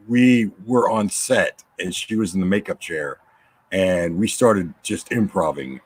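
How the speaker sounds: ordinary talk, nothing marked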